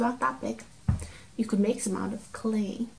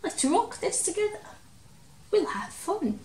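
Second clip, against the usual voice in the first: cheerful mouse voice; 7 semitones higher than usual. Acted. Mouse voice continues.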